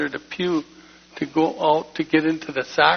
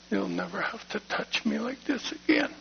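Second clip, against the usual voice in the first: hushed, much softer and higher, as if close to tears; a man kneeling behind the shop, quietly vowing to God; soft tearful prayer; 6 semitones higher, 9 dB quieter than usual. Retold or imitated. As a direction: breathe the promise softly to God. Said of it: "and I said".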